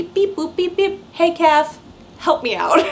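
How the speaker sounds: high playful beeps and call; phone beeps. Pretend. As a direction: beep boop like dialing a phone. Imitating phone call to Kathy.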